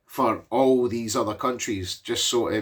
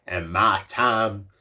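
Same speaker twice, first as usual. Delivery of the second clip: slow, low, deliberate mock old-timer voice; drawn out like a wise old man in a film; imitating the old man; 4 semitones lower, slower than usual. Retelling the film to the viewer, doing the old man slowly. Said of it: "I don't know why he talks like that".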